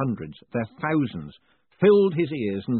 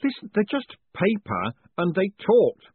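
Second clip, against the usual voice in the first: high, urgent disbelief; agitated protest; 11 semitones higher, 4 dB louder than usual. A narrator's reading. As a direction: insist on it, rattled and rising in volume. Agitated man arguing with golem.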